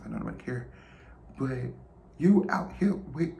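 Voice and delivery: usual speaking voice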